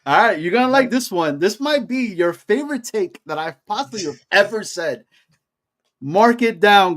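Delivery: high-pitched, building hot-take announcement; proclamation. Pretend. Host dates his boldest take for listeners, grand proclamation. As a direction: proclaim it high, slowing for the timestamp.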